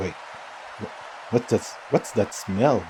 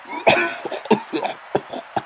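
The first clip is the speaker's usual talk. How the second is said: gagging cough. Acted. Choking on the food.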